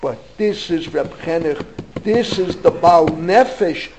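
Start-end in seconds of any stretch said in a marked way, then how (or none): none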